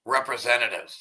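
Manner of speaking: normal talking voice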